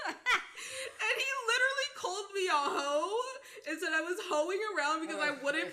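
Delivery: telling it high and loud through laughter; laughing. Real, not acted. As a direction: keep laughing through the words. Still laughing while telling.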